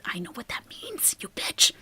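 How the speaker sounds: hushed, breathy aside with the insult; whispered insult. Retold or imitated. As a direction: whisper the jab under your breath.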